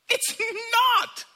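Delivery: "not" shot up high and loud; emphatic shout. Real, not acted. Preacher correcting his own mistake, loud emphatic shout. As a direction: bark the denial. Sharp contradiction of his old belief.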